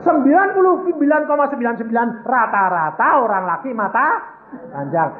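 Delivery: high, loud exhortation to a crowd; full-force preaching. Real, not acted. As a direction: drive each phrase home at full volume. (berkhotbah lantang berapi-api)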